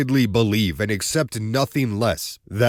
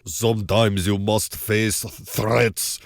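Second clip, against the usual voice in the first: slow, heavy fake accent mocking the actor; mock bad Eastern European accent; 2 semitones lower, slower than usual. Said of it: "His accent sounds terrible".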